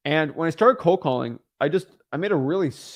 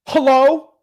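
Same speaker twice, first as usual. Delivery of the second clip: much higher, louder suspicious hello; curt hello; 10 semitones higher, 10 dB louder than usual. Acted. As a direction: answer the phone warily. Seller answers phone irritated.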